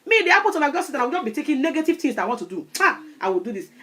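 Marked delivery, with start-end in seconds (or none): none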